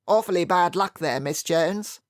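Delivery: a lightly voiced hearty Colonel; hearty old soldier offering sympathy. A narrator's reading. Colonel consoling Miss Jones after the game, lightly hearty.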